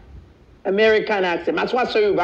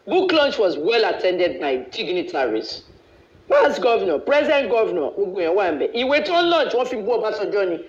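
ordinary talk

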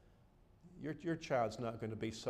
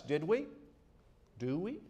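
raised, insistent preacher's voice; fervent preaching; 7 semitones higher, 6 dB louder than usual. Acted. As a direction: press the point home like a pulpit sermon. Preaching at full force.

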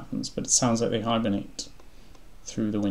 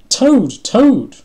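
high, loud, more desperate shout; crying out; 15 dB louder than usual. A narrator's reading. Frog calling unanswering Toad, crying out desperately. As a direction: call out with urgency. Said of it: "cried Frog".

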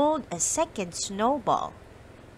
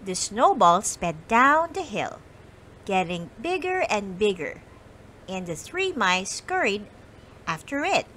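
ordinary delivery

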